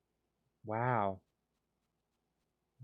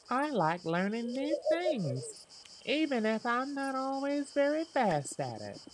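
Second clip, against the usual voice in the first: Luna's voice; 12 semitones higher than usual. Acted. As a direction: read Luna's line like a shy, gentle farm girl. Voicing villager Luna's dialogue.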